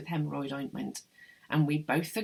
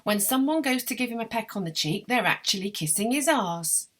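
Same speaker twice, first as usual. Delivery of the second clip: louder, higher voice for the punchline; animated, raised-voice gossip; gossiping client; 5 semitones higher, 6 dB louder than usual. A narrator's reading. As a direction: dish the gossip out loud and high. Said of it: "Frau Frenzel says".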